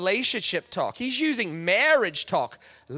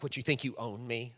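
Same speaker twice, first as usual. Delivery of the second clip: mock offended, quieter; indignant modern woman; 7 semitones lower, 9 dB quieter than usual. Imitating a modern woman bristling. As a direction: scoff at the old idea. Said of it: "today's woman would be like".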